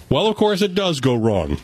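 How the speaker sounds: usual speaking voice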